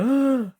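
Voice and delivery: high mock scream of fright; old man's scream. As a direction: shriek "ah" in pretend terror. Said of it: "Old man's like".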